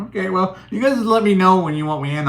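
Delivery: host mocking himself sitting alone while chat talks; mock sulky. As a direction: play the left-out host waiting his turn. Acting his own sulky remark.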